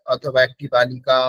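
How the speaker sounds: ordinary delivery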